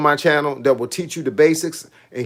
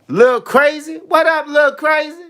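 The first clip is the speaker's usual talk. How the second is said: high, loud, excited shout-out; hyped shout-out; 12 semitones higher, 4 dB louder, slower than usual. Shown as a demonstration. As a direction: hype the name up like a big greeting. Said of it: "See how you gotta say that?".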